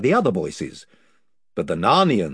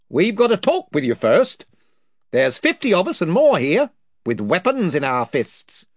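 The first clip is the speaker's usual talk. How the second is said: higher, blustering chief; invisible chief; 8 semitones higher, 4 dB louder than usual. Acted. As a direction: boast of his band's numbers. Chief voice speaks.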